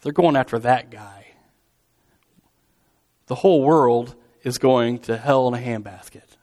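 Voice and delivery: write them off under your breath; acted weary disdain for the crowd; dismissive scorn. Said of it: "they're dismissing the crowd".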